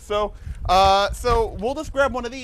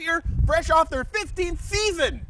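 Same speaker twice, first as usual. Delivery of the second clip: loud host yell; 7 semitones higher than usual. Acted. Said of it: "Does he always yell?".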